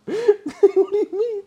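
high, slow frightened squeak through laughter; scared squeak. Retold or imitated. The hidden teller squeaks back at the raging stranger. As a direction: squeak it out like a terrified kid.

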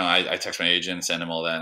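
usual speaking voice

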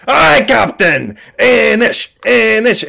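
high, loud pirate cheer; drawn-out, rousing sailors' shout; excited pirate crew. Acted. Pirate crew answering their captain, excited cheering. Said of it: "they get so excited".

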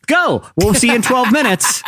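rally the congregation from the pulpit; rising impassioned oratory. Pretend. Pastor announcing from the stage.

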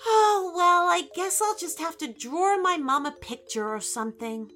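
put-on high little-girl voice, resigned; let-down Alice, starting with a big sigh of "oh"; disappointed. A narrator's reading. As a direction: settle for second best. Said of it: "said Alice disappointedly".

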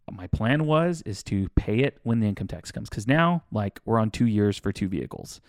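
normal talking voice

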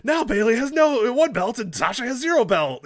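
mocking high, loud announcer yell; imitated commentator scream. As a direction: parody a hysterical wrestling commentator at full volume. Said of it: "Corey Graves screaming".

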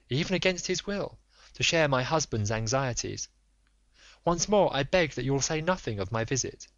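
high, urgent, imploring lady; a proud wife begging for the truth; pleading noblewoman. Acted. Lady takes her leave.

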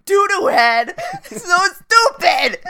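loud, high mock sobbing; mock self-loathing wail. Teasing co-host looking in the mirror, mock crying wail. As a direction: wail it, slowing down on the insult.

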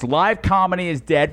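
normal talking voice

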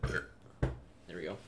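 mid-sip, mouth on the can; words squeezed out around a sip; while sipping a drink. Real, not acted. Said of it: "Sorry, I'm drinking a".

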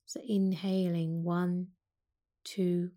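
calm yoga teacher guiding a breathing practice; guided meditation. Acted. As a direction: ease them into the breath, unhurried. Leads a seated breath meditation.

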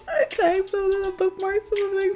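high-pitched excited squeal; squealing fangirl. Real, not acted. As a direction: squeal over a favorite couple. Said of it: "having a whole mental breakdown".

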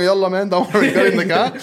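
high, loud, cheery no-worries call; breezy reassurance. Retold or imitated. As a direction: brush the crash off, cheerfully loud. Retelling the crash scene.

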